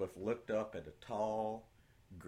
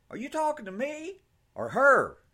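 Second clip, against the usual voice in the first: sassy retort; 12 dB louder than usual. Acted. Elizabeth retorts.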